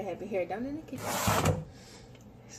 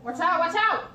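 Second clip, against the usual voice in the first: high urgent shout; calling out warning; 8 semitones higher, 4 dB louder than usual. Real, not acted. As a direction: yell a sharp warning. Warning someone in kitchen.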